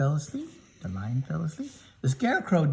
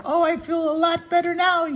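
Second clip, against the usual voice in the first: high, relieved Tin Man; storyteller voicing a high grateful Tin Man; 5 dB louder than usual. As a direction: pitch up as the freed Tin Man.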